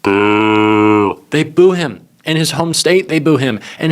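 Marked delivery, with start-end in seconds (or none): 0.0-1.2 a louder low mock "Boo" from the crowd; imitating a booing rally crowd; drawn-out imitated boo, louder. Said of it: "all you hear is".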